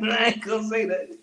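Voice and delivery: laughing through the line. Real, not acted. Teasing about the song, breaking into laughter. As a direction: crack up while saying it.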